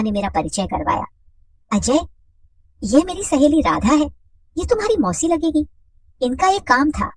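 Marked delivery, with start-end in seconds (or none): 1.7-7.1 higher, confident friend; reassuring woman's voice. Acted. Mother reassuring Radha, higher. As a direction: voice her up high, sure of herself.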